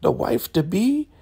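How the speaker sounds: very high sugary voice; sugary fake sweetness. Marva sweet-talking Malcolm, high sugary. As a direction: lay the sweetness on thick. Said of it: "she's like".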